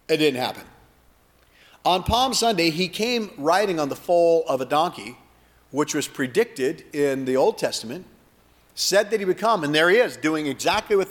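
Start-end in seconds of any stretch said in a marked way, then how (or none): none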